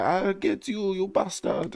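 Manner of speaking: high-pitched trainer impression; mock threatening woman. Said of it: "And she's like".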